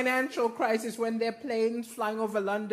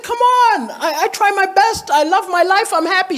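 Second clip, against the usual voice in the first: defensive pleading; 7 semitones higher, 10 dB louder than usual. Retold or imitated. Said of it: "I said".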